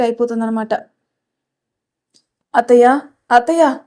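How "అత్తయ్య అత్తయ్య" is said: mildly raised call for aunt; a touch of insistent hollering; calling out loudly. Acted. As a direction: call through the house for your aunt. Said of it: "చెవు కోసిన మేకలా అరుస్తున్నావు".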